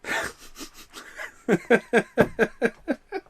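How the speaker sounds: high, long, tearful laughter; helpless laugh. Real, not acted. Laughing at his frozen action figure, helpless tearful laugh. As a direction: laugh till it nearly turns to crying. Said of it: "laughs at frozen figure".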